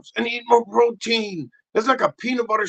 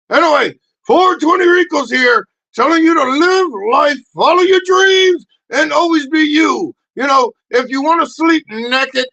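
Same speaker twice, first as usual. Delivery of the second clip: booming, much higher, much louder pep talk; loud sign-off; 8 semitones higher, 9 dB louder than usual. Acted. Rico hyping up his audience, loud and high. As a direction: shout it like a hype man.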